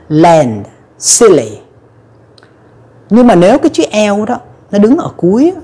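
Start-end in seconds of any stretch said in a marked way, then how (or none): 0.1-1.6 land, silly with the tongue pushed out for L. Shown as a demonstration. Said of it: "Mình bật cái lưỡi ra". (L đầu từ, bật lưỡi ra)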